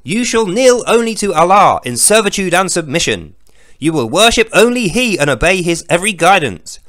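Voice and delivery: loud, solemn, hammed-up holy proclamation; booming scripture reading. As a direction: thunder the verse like a mock prophet. Reads verse in pompous declaiming voice.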